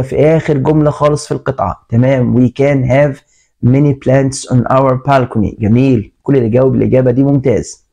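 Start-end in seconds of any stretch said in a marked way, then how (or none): 2.4-5.6 slow dictation. Shown as a demonstration. Teacher dictates answer sentence.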